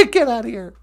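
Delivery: high puppet brushing off a compliment; flustered puppet. Acted. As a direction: wave it off like a blushing puppet.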